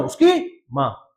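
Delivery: उसकी माँ said slowly with weight; emphasis. Shown as a demonstration. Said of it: "अभी जोर दे रहा कौन?".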